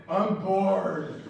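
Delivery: imitated bored whine; whining kid. Kid in the theme park queue, mock bored whine. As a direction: stretch it out like a bored child. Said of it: "Whining in the wilderness".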